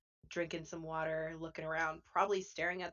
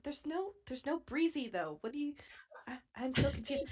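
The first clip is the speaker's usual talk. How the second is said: higher, puzzled little voice; confused Chip; 9 semitones higher than usual. Acted. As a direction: search for the missing breeze, baffled. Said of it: "She's like".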